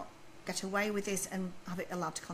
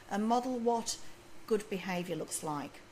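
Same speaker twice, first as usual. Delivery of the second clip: ordinary talk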